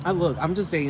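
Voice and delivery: ordinary talk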